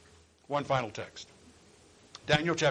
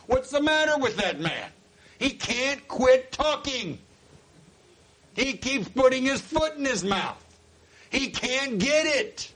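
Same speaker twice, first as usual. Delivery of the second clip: full-force preaching; 9 semitones higher than usual. Real, not acted. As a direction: preach it hard with a catch in the throat.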